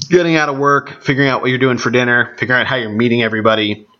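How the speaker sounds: normal talking voice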